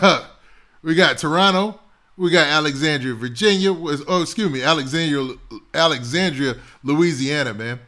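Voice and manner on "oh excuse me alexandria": usual speaking voice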